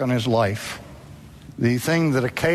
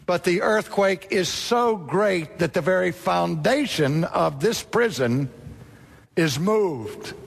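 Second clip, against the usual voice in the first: normal talking voice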